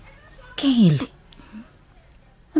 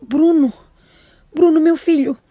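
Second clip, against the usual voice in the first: higher, louder cry of alarm for her son; 4 semitones higher, 4 dB louder than usual. Acted. Mother hearing her son may die, alarmed cry. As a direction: cry out for your son in fright.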